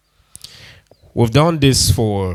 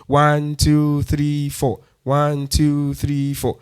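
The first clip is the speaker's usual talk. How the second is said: counting the beat; 3 semitones higher, much slower than usual. Shown as a demonstration. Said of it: "we are going to work with four over four".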